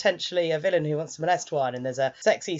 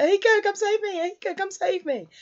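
very high, put-on helpless wail; hammed-up cry for rescue; mock damsel plea; 14 semitones higher than usual. Captive boy calling his friend to save him, mock helpless.

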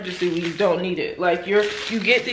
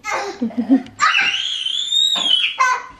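high, shrieking burst of laughter. Real, not acted. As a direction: crack up with delight. Mom laughs loudly.

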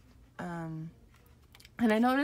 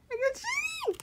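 very high, crying squeal; overwhelmed fan delight. Real, not acted. Reveals the photocard.

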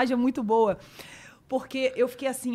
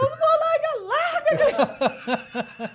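laughing high squeal; 5 dB louder, much slower than usual. Retold or imitated. Retold scuffle, laughing.

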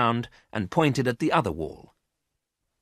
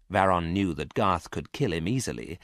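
plain reading voice

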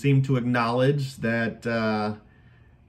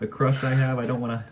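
ordinary talk, nothing marked

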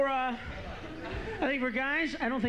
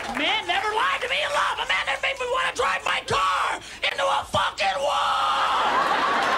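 ranting shout; 13 semitones higher than usual. Acted. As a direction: yell it like the heartbreak still stings. Comic ranting back at hecklers.

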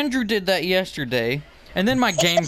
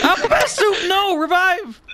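laughing shout; 4 dB louder than usual. Real, not acted. Panicked laughing during game.

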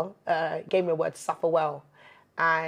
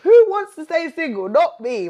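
animated; 9 semitones higher, 8 dB louder than usual. Real, not acted. Joking about wanting to date.

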